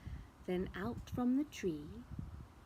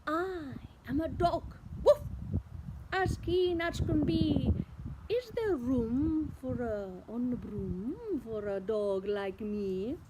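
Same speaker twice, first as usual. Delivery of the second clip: eager dog. Acted. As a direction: ask it keenly like a hopeful dog. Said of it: "then eagerly said".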